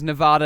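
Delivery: Nevada with a broad "ah"; pretentious Nevada. Shown as a demonstration. Dismissing it as for pricks, Nevada said with "ah". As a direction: say Nevada as nuh-VAH-duh. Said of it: "it's just for pricks".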